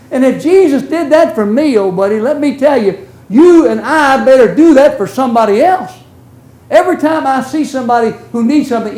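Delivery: preaching. Acted. Preacher urging the congregation to show mercy, rising and forceful. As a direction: drive the point home to the flock.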